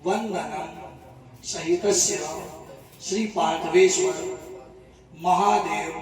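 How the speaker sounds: measured, word-by-word Sanskrit intoning; ritual sankalp recitation. Acted. Priest reciting the sankalp for the devotee in measured intoning. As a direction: intone each phrase of the vow with pauses between.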